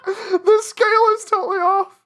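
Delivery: high, loud laughing through the words; cracking up at a wonky sketch. Real, not acted. Laughing at his own lopsided VR drawing.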